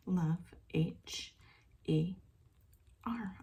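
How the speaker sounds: each letter whispered apart with long gaps; slow spelled channeling. Acted. Channel spelling the spirit's loving message, hushed and slow.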